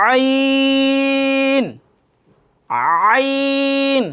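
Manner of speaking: আইন from deep in the throat; আইন said with the throaty Arabic ain. Shown as a demonstration. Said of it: "শব্দটা হবে আইন, একটু গাঢ়".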